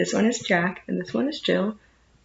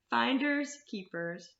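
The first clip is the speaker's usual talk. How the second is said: ordinary delivery, nothing marked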